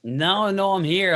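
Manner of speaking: high, loud, quick denial; loud protest. Real, not acted. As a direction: insist loudly that you are awake. Answers loudly when asked if sleeping.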